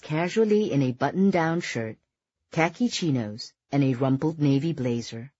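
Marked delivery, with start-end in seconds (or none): none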